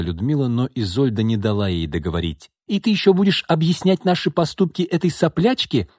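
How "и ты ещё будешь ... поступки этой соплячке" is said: high-pitched scornful outburst; high, indignant voice of a cutting aunt; indignant exclamation. A narrator's reading. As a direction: exclaim it in acted outrage. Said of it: "воскликнула она".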